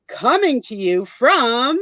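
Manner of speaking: drawn-out, high sing-song show announcement; show jingle announcer. Acted. Host unveils the show's new logo, grand drawn-out announcer. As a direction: stretch it out big like a theme jingle.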